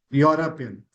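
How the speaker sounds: "European" as YAW-rup-ian; correct pronunciation. Shown as a demonstration. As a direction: start "European" with yo, falling at the end. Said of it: "So the pronunciation is Europe".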